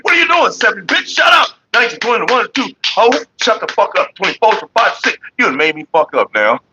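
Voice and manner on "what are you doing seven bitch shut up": hammed-up shouting; mock shout. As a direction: bark it out between counts. Laughing shouting while counting strokes.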